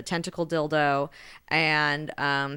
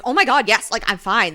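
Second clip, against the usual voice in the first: eager gush; 5 semitones higher, 10 dB louder than usual. Retold or imitated. Zuri eager to forgive.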